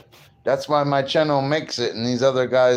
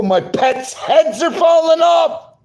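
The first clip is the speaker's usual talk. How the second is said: mock wail; 7 dB louder, slower than usual. Real, not acted. Laughing at ladybug's head falling.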